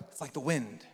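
low, soft mystical voice; ethereal. Retold or imitated. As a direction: float it like a wise sage. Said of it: "Jesus gets real ethereal".

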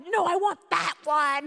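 high, loud, drawn-out retold protest; stubborn tantrum. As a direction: insist like a furious kid. Retold yelled refusal.

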